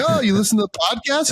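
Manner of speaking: high, delighted surprise; surprised question. Retold or imitated. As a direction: sound pleasantly caught off guard. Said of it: "I'm like".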